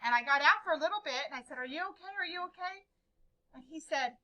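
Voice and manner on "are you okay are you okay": higher, softer repeated check; acted-out concern for dad; worried. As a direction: ask with mild worried urgency. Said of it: "I was really worried about him".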